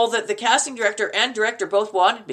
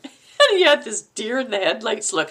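ordinary delivery

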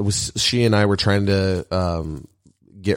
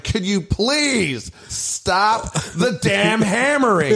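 much higher, slow, strained mock plea; exasperated plea; 14 semitones higher, much slower than usual. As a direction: stretch it out like you're at your wit's end. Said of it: "And I was like".

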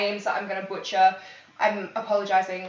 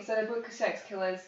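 ordinary talk, nothing marked

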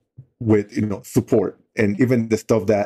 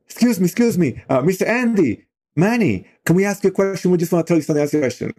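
put-on higher, eager kid voice asking politely; polite young boy; 8 semitones higher, faster than usual. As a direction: pitch it up like a shy teenager asking a favor. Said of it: "come up to us and say".

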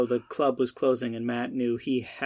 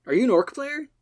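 excited squeak; 11 semitones higher than usual. Acted. Matt thrilled by nerd girl.

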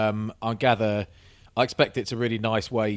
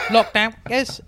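high, loud jeer through laughter; mock heckle; 12 semitones higher, 6 dB louder, slower than usual. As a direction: call it out like a lad from a car window. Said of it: "he's leaning out the window".

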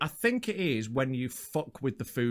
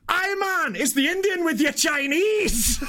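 high, loud Scottish shout, slow at the end; Glaswegian accent; 13 semitones higher, 4 dB louder, slower than usual. Retold or imitated. Delivery man corrects the confused customer in a Glaswegian accent. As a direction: bellow it in broad Glaswegian. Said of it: "He was a Glaswegian".

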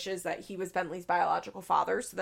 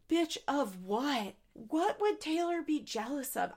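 incredulous; 6 semitones higher than usual. Real, not acted. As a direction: stretch it in outraged disbelief. Outraged reaction to Jen.

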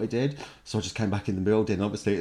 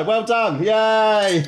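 a louder, higher little cheer; mock cheer; 10 semitones higher, 5 dB louder, much slower than usual. Declaring the quiz a tie, playful mock cheer. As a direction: cheer yourself on, half joking.

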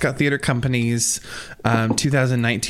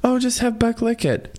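mock casual; 10 semitones higher than usual. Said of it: "I love how you went from".

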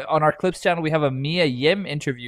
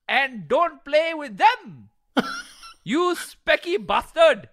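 put-on strong Pakistani accent; 11 semitones higher than usual. Angry father scolding his son Tony in a Pakistani accent. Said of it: "going in a strong Pakistani accent".

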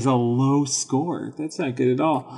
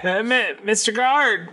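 high character voice for Scud; Scud, tattletale; 8 semitones higher than usual. Acted. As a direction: call out high as the snitch. Scud's player calls guard in character.

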